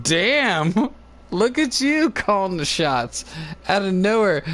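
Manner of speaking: high, slow mock-impressed; amused surprise. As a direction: tease her for bossing you around. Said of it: "I was like".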